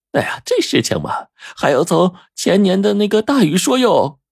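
lilting landlady rising high at the end; landlady storyteller. A narrator's reading. Landlady starting the tale of the rains, lilting storyteller. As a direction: launch a long tale in landlady's voice. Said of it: "老板娘这个时候就说".

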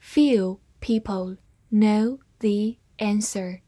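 slow, careful reading of "Few people know the answer"; slowed-down model sentence. Shown as a demonstration. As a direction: read it slowly and savour it. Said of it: "我们慢读来仔细体会一下".

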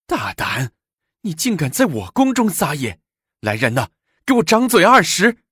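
high-pitched imperious outrage, building; furious command. A narrator's reading. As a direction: build from rebuke to a shrieked order. Empress orders consort slapped. (勃然大怒地尖声下令)

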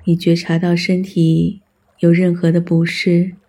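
ordinary delivery

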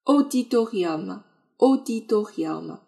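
slow, measured model of auditorium; careful pronunciation of auditorium. Shown as a demonstration. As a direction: sound out auditorium slowly for learners. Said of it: "请注意它的发音".

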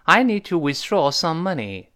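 slow, clear model sentence for the class; slow model reading. Shown as a demonstration. Teacher reads the example sentence slowly for students. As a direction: read "I need to withdraw some money." slowly and clearly. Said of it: "teacher models the sentence".

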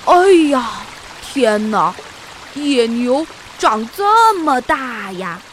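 loud, slow gasp of wonder; amazed exclaim. A narrator's reading. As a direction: marvel at how huge it is. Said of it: "比利惊叹道".

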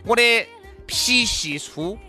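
ordinary talk

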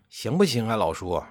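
plain reading voice

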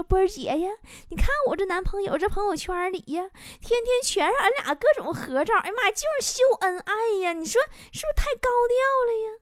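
put-on high, soft, cooing girlfriend; imitated showing off. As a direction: gush with fake complaint to flaunt the boyfriend. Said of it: "跟我俩显摆呢". (模仿闺蜜又高又软地显摆)

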